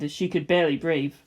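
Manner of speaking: ordinary delivery, nothing marked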